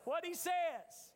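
slow, high, every word weighted; emphatic answer. Real, not acted. Preacher answering his own question, slow and emphatic. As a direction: drive it home slowly. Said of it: "He'll have what?".

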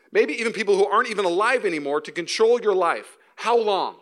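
quoting a pastor with building force; preaching. Acted. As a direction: press each question hard on the congregation. Reads Andy Stanley quote forcefully.

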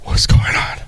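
hushed, startled whisper; murmuring inmates asking each other. Retold or imitated. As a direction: breathe it like a nervous inmate. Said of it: "everybody's like".

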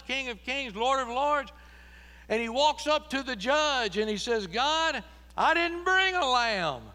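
loud, high, rolling preacher's litany; full-force preaching. Acted. Jesus' titles in a preaching climax.

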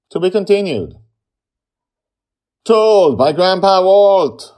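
loud, high, ringing announcement; announcer sign-off. Real, not acted. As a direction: belt out the closing credit.

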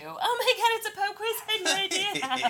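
high fake surprise; mock surprise. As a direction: play fake astonished, squealing high. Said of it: "accidentally stumble onto".

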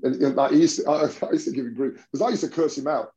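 usual speaking voice

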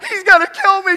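high, loud panicked shriek; terrified scream. Retold or imitated. Sister fleeing her brother through the house, shrieking in terror. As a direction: scream it while running away.

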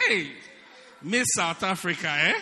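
loud mock flattery; sarcastic praise. Preacher mocks a girl as a beauty queen, loud sarcasm. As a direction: hail her loudly with false praise. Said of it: "you say".

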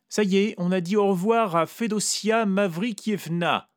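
high, loud voice for Vera; Vera declaring. A narrator's reading. Vera tells the narrator she said goodbye, high and loud. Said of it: "a-t-elle déclaré".

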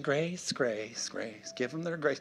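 hushed, soothing parody of lenient churches; softly mock the easygoing preacher; mocking soft preaching. Preacher imitates churches preaching only grace, mockingly soft. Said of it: "we got churches preaching".

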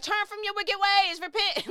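mock street preacher, high and loud. Imitating a preacher telling people to repent. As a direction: call them to repent like a preacher. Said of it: "where he's like".

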